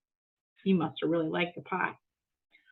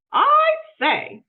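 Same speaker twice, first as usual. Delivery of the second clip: high, loud call; 9 dB louder than usual. Acted. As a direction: call it across the house. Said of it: "he called".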